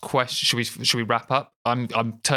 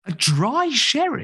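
surprised; 12 semitones higher, 4 dB louder, slower than usual. Real, not acted. Reacting to a surprising drink.

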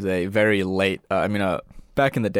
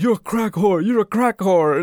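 high, louder mock insult; imitated angry rant; 10 semitones higher, 5 dB louder than usual. Man going off at a woman about drugs, high mock rant. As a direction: fling it out like a street quarrel. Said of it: "they were going off about".

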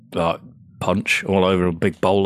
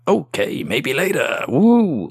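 put-on whooping like an old prospector; gleeful yelp of an old gold-rush miner; 7 semitones higher, 4 dB louder, much slower than usual. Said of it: "Go off dancing like an old prospector".

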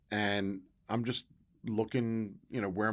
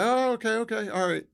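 high, loud dawning "oh"; retold burst of catching on; sudden recognition; 13 semitones higher, 7 dB louder than usual. Player placing the college at last, suddenly enlightened. Finally learns where Rowan is.

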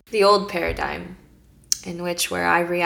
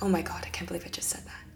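fast shocked whisper; 10 dB quieter, faster than usual. Retold or imitated. Stylist whispering in horror at what she blurted.

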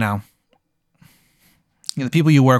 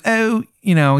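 bad Maryland accent; 9 semitones higher than usual. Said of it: "Maryland accent is so bad".

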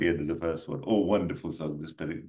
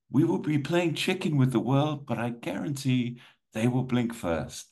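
higher-pitched, theatrical film villain; villain's speech; 8 semitones higher than usual. Acted. Storyteller voicing the villain warning nasa from space, theatrical threat. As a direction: deliver a raised, measured threat.

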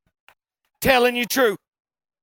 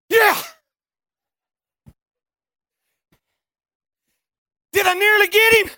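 high, loud eager holler; dazed but thrilled yell; shouted from the ground; excited shout; 11 semitones higher, 8 dB louder than usual. Retold or imitated. Fallen rider answers buddies.

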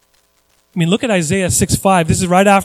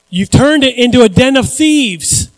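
high, loud declaimed accusation; loud rebuke; 5 semitones higher, 6 dB louder than usual. Acted. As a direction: thunder it out as a rebuke. Jesus' rebuke, first take declaimed loud.

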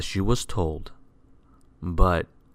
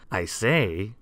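usual speaking voice

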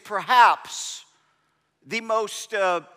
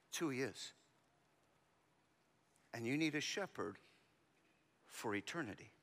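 soft intimate preaching; 9 semitones lower, 15 dB quieter than usual. Real, not acted.

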